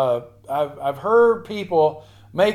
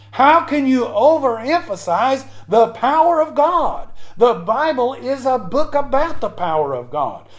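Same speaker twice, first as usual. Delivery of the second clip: raised-pitch, impassioned pulpit delivery; fervent preaching; 4 semitones higher than usual. Real, not acted. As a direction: launch into an emphatic challenge to the congregation. Preaches at full force.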